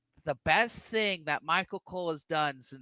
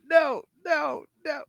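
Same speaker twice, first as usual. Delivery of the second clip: exasperated no; slower than usual. Retold or imitated. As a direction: beg the screen to stop it. Yelling at TV from home.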